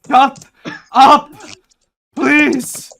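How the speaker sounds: loud unhinged scream. Pretend. Mock unhinged outburst.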